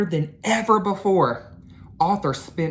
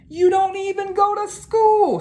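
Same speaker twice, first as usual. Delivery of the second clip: much higher exasperated big brother; exasperated kid; 5 dB louder than usual. Acted. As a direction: scoff at the little sister. Arthur to little sister.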